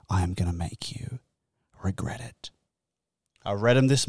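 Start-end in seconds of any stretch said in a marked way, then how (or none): 0.1-2.5 low, breathy unvoiced threat that fades away; menacing whisper. Host whispering a mock threat to complaining listeners, menacing whisper. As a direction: hiss the threat at the listeners like a villain. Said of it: "I'm gonna make you regret it".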